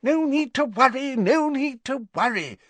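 high, hurried Fudge call-out; Fudge shouting. Acted. Fudge calls back to the Prime Minister from the flames, high and hurried. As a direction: throw it back over your shoulder as you leave. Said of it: "Fudge had shouted".

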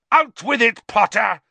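high, loud furious roar; bellow. A narrator's reading. Enraged professor accusing Potter, bellowing. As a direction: bellow an accusation at full force. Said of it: "he bellowed".

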